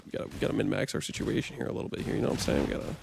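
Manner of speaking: soft fast mutter; low whispery mumble to self; muttering quietly. Real, not acted. Muttering strategy to himself.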